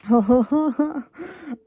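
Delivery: bright burst of laughter at the card; sudden loud amused laugh. Real, not acted. Reacting to drawn card.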